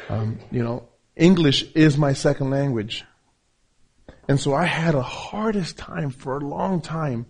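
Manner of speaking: ordinary talk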